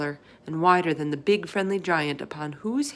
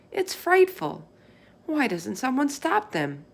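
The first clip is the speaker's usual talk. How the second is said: put-on higher, horrified girl's cry; Sophie crying out; 8 semitones higher than usual. Sophie crying out to the giant in horror. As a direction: burst out in horror as a girl.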